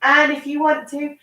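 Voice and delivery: slow, gentle yoga cueing; calm instructions for a small child; reading to a toddler. Acted. Guides a child through yoga poses.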